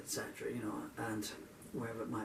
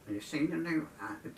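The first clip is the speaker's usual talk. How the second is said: crone voice; 6 semitones higher than usual. Retold or imitated. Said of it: "with a crone voice".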